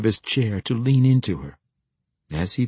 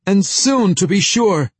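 raised, high, insistent call; calling after her; 11 semitones higher, 8 dB louder than usual. A narrator's reading. Lord promises the fleeing guest another visit, calling after her. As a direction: throw it loudly after a departing guest. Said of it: "Lord Ferringle said after her".

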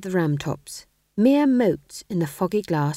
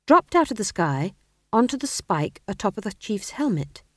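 usual speaking voice

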